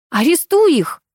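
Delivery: high-pitched shrieked demand; sharp high outburst; squeal. A narrator's reading. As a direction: squeal the order in alarm. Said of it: "взвизгнула я".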